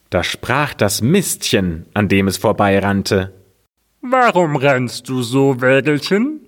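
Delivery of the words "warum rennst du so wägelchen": higher, odd little dung heap voice; a grubby muck heap calling out; raised dung heap voice asking. Acted. Dung heap asks the cart.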